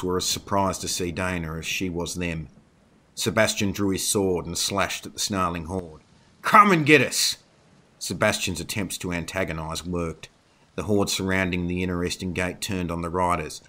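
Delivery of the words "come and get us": loud, high, falling challenge; shouted battle taunt. A narrator's reading. Sebastian slashes at the snarling horde to antagonise it, shouted taunt. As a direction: bellow a dare at the enemy.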